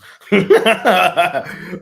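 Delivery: big loud burst of laughter; cracking up hard. Real, not acted. Laughing at his own plus-money bet, loud laugh.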